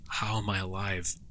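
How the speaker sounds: ordinary delivery, nothing marked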